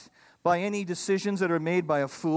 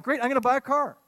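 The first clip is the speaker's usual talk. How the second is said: put-on higher, eager young man's thought; excited; 7 semitones higher, 4 dB louder, faster than usual. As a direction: gush about buying a dream car. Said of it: "I thought".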